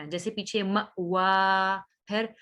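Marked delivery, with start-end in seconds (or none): 0.6-1.8 मवा with the final "aa" held long; stretched last vowel. Shown as a demonstration. Teacher showing verse endings to stretch, मवा drawn out. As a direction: draw out the end of मवा slowly. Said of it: "इनको आपने खींचना".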